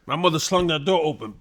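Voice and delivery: normal talking voice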